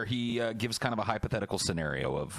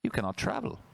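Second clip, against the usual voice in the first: usual speaking voice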